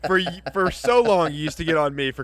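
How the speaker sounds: loud laughing callout; mock outrage, loud; playful scolding. Real, not acted. Playful scolding over clap.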